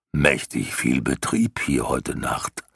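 low, slow creaking rock voice. Acted. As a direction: grind it out low and slow like stone. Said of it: "sagte er mit knarrender Stimme".

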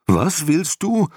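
high, slow startled dragon cry. A narrator's reading. Dragon startled by the boy's plan, alarmed high cry. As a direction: jump with fright as you ask. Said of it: "rief der Drache und machte vor Schreck einen Sprung".

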